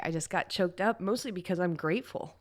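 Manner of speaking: usual speaking voice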